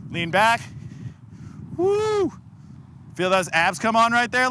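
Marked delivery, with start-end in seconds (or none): 1.8-2.3 high-pitched drawn-out "woo"; high whoop. Real, not acted. Coach cheering lifters as they lean back, high whoop. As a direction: let out a high, airy whoop.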